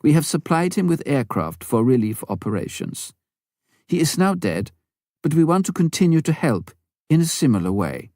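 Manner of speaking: a hint of a courteous foreign chairman explaining; Swedish gentleman explaining. A narrator's reading. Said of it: "Ture explained".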